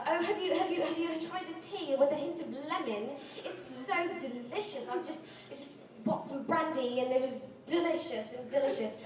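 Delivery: put-on British accent. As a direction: imitate a stammering British traveller. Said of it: "U K person".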